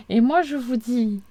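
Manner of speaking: ordinary talk, nothing marked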